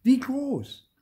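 very high, loud insistent repeat. A narrator's reading. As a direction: push the question harder. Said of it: "war begeistert und rief aus".

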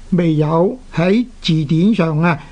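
normal talking voice

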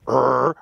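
a low throaty growl, lightly done; soft growl demo. Shown as a demonstration. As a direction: growl softly in the throat like a bull elk. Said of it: "you can growl a little bit in the beginning".

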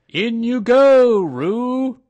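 high, loud drawn-out call; Rabbit's big, loud announcement. A narrator's reading. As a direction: call it out loudly and slowly, up high. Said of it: "said in a loud voice".

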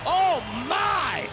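high excited play-by-play shouting; shocked commentator. Real, not acted. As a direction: react in horror to the choke.

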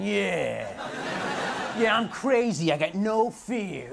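a lightly voiced dumb macho guy, slowing down at the end; mock tough dork. Comic mocks a dork in a slogan shirt, a mild dopey macho voice. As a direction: play the dork bragging, drawn out and dopey. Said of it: "standing there wearing the shirt going".

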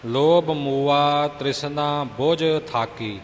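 slow, deliberate verse reading with long vowels; slow model recitation. Shown as a demonstration. Teacher modelling scripture verses for a student, slow and measured. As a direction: recite it slowly, word by word.

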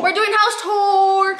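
high, drawn-out shout; excited announcement. Real, not acted. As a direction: belt out the announcement, stretching it. Announcing a house tour to viewers.

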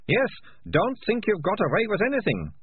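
put-on high, defiant girl's voice. A narrator's reading. Malicia warning the rat catchers, high voice. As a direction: throw the threat up high.

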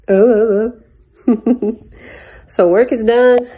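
ordinary talk

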